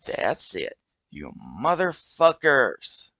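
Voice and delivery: slow, drawn-out angry yell; furious snap. Acted. The kid finally losing it at the hobos, slow angry yell. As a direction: lose it and lash out.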